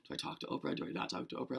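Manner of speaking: hushed, anxious back-and-forth to yourself; half-whispered retold dithering; nervous inner debate. Fan debating with herself during the play, nervous. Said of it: "nervous now as the play is going on".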